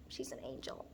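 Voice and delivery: hushed, adoring whisper; awed whisper. Acted. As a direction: whisper it in reverence. Awed aside about imagined Taylor.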